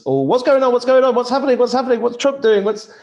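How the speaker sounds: high, loud, panicky mimicry of worried people; squeaky frantic questions, mocking. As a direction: parody the freaking-out crowd. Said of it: "everybody's freaking out".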